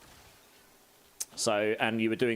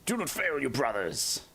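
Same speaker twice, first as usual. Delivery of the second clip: high, strained soldier's command; Space Marine battle cry; 5 semitones higher than usual. Retold or imitated. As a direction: bark it like a zealous space warrior. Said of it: "I can do a few of them".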